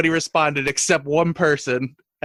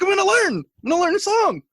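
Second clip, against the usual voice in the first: very high, loud eager vow; excited vow; 12 semitones higher, 3 dB louder than usual. Retold or imitated. Roommate vowing to learn a song, high and eager. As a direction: promise it like an excited kid. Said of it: "and you're like".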